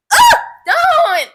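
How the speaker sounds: loud high yelp of disgust; yelped recoil. Retold or imitated. Woman retelling the unwanted hug, high loud shriek.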